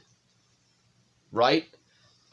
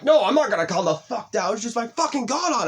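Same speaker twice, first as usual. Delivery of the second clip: much higher, louder outburst, snapping back; retold angry yell; 12 semitones higher, 4 dB louder than usual. Zach snapping at his friends in a furious yell. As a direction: snap back, refusing to calm down. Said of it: "I fucking just snapped".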